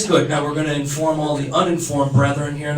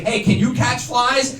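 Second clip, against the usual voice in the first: acted question; 7 semitones higher, slower than usual. Acting a greeting to a stranger.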